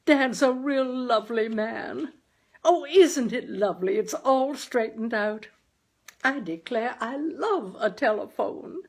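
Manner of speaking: high and loud with delight; gushing happily; jubilant mother. A narrator's reading. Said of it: "exclaimed my mother jubilantly".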